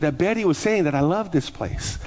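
ordinary talk, nothing marked

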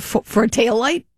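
can't believe it's over a taillight; retold reply to the officer, incredulous. Disbelief at impound threat.

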